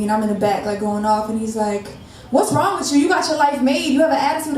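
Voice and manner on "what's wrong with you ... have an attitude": higher-pitched mock of a rude customer; retold customer attitude. Customer confronting a worker in the back, mock attitude. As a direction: imitate a customer giving attitude. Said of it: "got an attitude with me".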